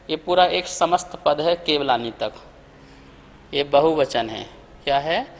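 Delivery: ordinary talk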